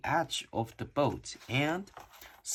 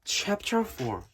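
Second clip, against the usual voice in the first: plain reading voice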